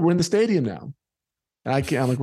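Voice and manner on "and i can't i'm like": ordinary delivery, nothing marked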